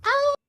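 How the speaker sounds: high little yelp; light mock scream. Whistle flying off the ship, a high yelp. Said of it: "there's a light scream".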